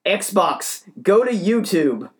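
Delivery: high, loud voice command; mock yell at a machine that won't listen; imitated screaming. Neighbour commanding his Xbox, mock screaming. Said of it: "he's just screaming his head off".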